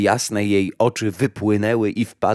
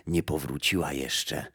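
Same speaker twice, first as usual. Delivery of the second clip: put-on low, much softer old woman; old grey woman; 5 semitones lower, 8 dB quieter than usual. Old woman tells the blind mother death is not back, low and soft. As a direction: answer like a quiet old gardener of graves. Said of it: "odparła jej stara siwa niewiasta".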